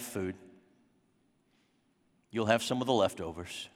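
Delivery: a stern dad coolly shutting out his returning son; cold rejecting father. Pretend. Older brother imagines dad rejecting the prodigal, icy and quiet. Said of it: "he wanted Dad to say".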